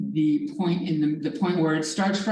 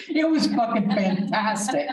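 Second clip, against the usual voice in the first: higher, laughing through the words; laughing delight; 5 semitones higher, 3 dB louder than usual. Real, not acted. Teacher loving own grad school story, laughing delight. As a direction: crack up on the way through.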